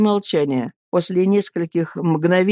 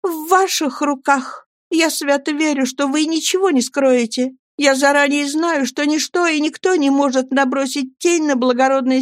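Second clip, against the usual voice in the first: put-on a higher, earnest young wife's voice; Annie pleading; 8 semitones higher than usual. Young wife begging her friends to speak, pleading.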